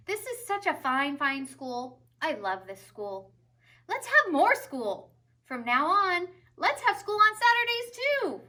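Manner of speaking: booming high proclamation, building; rousing head teacher speech; proud principal. A narrator's reading. Principal to students and teachers, grand and rising. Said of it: "Mister Keene called all the students".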